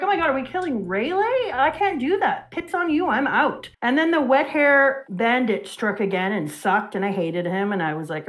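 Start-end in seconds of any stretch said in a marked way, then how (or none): 0.0-3.7 high-pitched, rushed mock protest; hammed-up horrified reaction; retold outraged disbelief. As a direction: relive the shock in a high, indignant voice.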